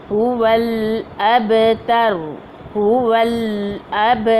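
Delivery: the ब of अब bounced as a light echo, slow; qalqala on ba. Shown as a demonstration. Teacher demonstrating qalqala sughra on अब, slowly. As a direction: give the ba a small qalqala bounce. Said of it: "कलकलाए सुगरा अब".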